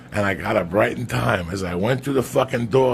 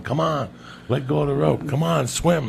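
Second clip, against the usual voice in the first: urging swimmers; 6 semitones higher than usual. Retold or imitated.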